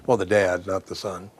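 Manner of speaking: usual speaking voice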